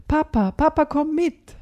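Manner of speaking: put-on a small boy tugging his dad along; excited. A narrator's reading. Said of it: "Aufgeregt zieht Tom".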